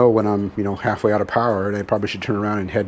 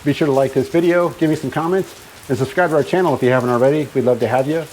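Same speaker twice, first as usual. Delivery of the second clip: upbeat outro; 4 semitones higher, faster than usual. Acted. Channel sign-off outro.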